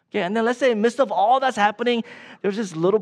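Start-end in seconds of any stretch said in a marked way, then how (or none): none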